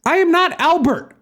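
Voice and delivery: high-pitched, forceful denial; commanding. A narrator's reading. Narrator corrects the mistaken caller, commanding. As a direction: insist in one heated run. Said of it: "I commanded".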